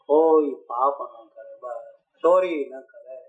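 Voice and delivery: slow, low, weighty moral command; slow preacher warning, each word weighed; slow solemn warning. Real, not acted. Preacher to listeners, slow and low as he warns against sin.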